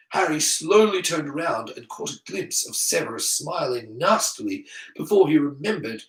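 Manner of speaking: usual speaking voice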